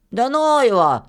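put-on high, bold boy's retort; Filka, dismissive boy. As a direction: brush the objection off confidently. Boy brushes off the objection.

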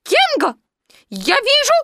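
very high squeak trying to be manly; bravest piglet voice. A narrator's reading. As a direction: squeak it out as bravely as you can. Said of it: "сказал самым мужественным голосом". (писклявый голосок, изображающий мужество)

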